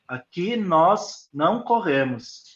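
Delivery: slow, with "nós" and "não corremos" each stressed; measured emphatic rule. Shown as a demonstration. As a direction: spell out the rule slowly, leaning on "nós". Models limit phrase for child.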